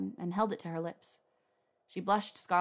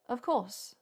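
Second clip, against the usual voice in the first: higher character voice, slightly stiff; stiff and formal; 5 semitones higher than usual. A narrator's reading. Hermione agrees to make the dressing, stiff and formal. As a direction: answer with mild formality. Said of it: "she said in a stiff, formal voice".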